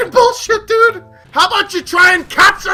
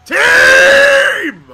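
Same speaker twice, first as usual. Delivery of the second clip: high, loud, drawn-out call; yelling for the team; 8 semitones higher, 7 dB louder than usual. Real, not acted. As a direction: scream for your teammates, stretching the word. Calling out to the team again.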